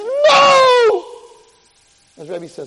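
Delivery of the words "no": very high, loud, long scream; desperate high-pitched wail. Retold or imitated. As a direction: scream in terror as claws dig in. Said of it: "Chaim screams".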